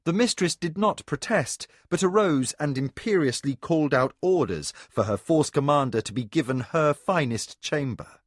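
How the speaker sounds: ordinary delivery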